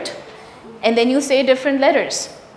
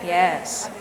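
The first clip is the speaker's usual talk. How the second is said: normal talking voice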